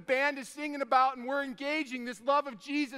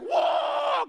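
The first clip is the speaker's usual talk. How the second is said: a high, loud whoop of speed; excited yell; 8 dB louder than usual. Retold or imitated. Dad racing son to hospital, whooping loudly. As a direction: yell it out as the truck flies.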